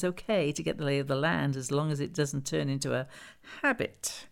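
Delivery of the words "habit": ordinary delivery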